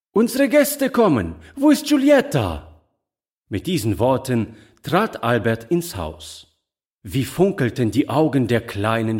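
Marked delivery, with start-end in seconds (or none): 0.1-2.8 high-pitched call through the house; excited calling out. A narrator's reading. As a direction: announce arrivals and call for someone. Albert arrives calling for Giulietta. (aufgeregt und hoch gerufen)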